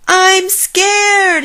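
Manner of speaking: very high, loud frightened scream. A narrator's reading. Jim overwhelmed by the dark and noises, screaming. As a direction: scream out your fear. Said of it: "he screamed".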